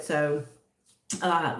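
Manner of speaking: normal talking voice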